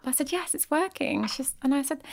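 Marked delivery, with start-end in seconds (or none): 0.3-1.2 high, small-voiced mock confirmation; high-pitched reassurance. Nervous repairer to celebrity client, high-pitched reassurance. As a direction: answer high, playing it innocent.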